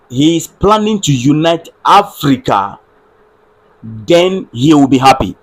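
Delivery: usual speaking voice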